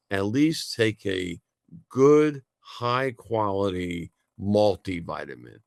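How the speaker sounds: slow down and land hard on "good, high quality"; emphatic stress. Real, not acted. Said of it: "I emphasize the word high quality".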